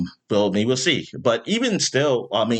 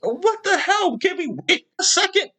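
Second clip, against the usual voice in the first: high, laughing disbelief; incredulous outburst. Retold or imitated. Mocking Twitter fans for panicking, high laughing disbelief. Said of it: "It's like".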